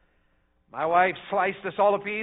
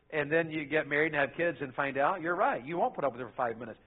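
normal talking voice

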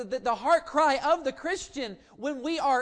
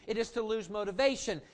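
usual speaking voice

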